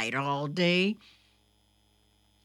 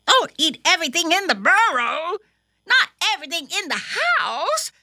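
high, loud, rapid scolding; mother scolding; 11 semitones higher, 9 dB louder, much faster than usual. A narrator's reading. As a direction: scold a greedy child, fast and high. Mother scolding Thumper at mealtime.

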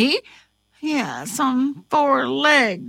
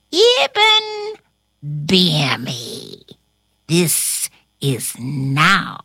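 slow, mostly low witch incantation; wheezed spell; 9 semitones lower, much slower than usual. A narrator's reading. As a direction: wheeze out a magic chant, drawing out the last words. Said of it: "wheezed out these words".

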